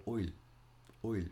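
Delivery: "oil" with the vocal coach's drawn-out oy; imitated vowel. Retelling a viral accent coach, imitated "oi" vowel. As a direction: say "oil" as the coach teaches the Irish I. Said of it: "she was like, "I".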